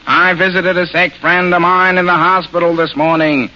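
flat, nasal deadpan; Ned Sparks. Shown as a demonstration. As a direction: drone it evenly through the nose. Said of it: "speaking in the flat nasal voice of Ned Sparks".

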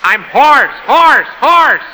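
raising the voice to a high, loud shout. Acted. Professor shouting to a student who can't hear him. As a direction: yell it down a bad phone line. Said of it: "You'll have to talk louder".

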